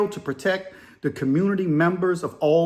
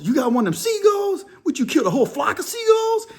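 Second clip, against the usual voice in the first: much higher, mocking disbelief; incredulous; 11 semitones higher than usual. Inmate hearing the seagull story, incredulous high voice. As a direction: pitch way up like you can't believe it.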